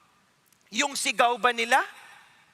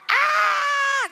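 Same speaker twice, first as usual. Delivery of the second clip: much higher, loud screaming yell; shrill shriek; 5 dB louder than usual. Shown as a demonstration. Preacher trying a higher second shout for the kids, shrieking. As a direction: scream it way up high. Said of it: "Ganyan ba?".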